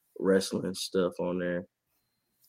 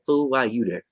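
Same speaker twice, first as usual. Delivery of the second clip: incredulous calling out; 7 semitones higher, 3 dB louder than usual. Retold or imitated. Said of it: "I'd be like".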